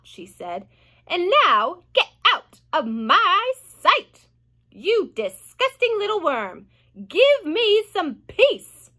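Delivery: hammed-up nasty aunt; cruel aunt. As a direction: drive the boy off in disgust. Aunt Spiker threatens James.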